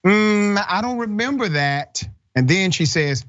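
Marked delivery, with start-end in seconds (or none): 0.1-2.0 high, mock-innocent teacher voice; imitating the teacher. Host mimicking the teacher's first denial, high mock innocence. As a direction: put on a higher voice, feigning forgetfulness. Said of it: "the teacher initially says".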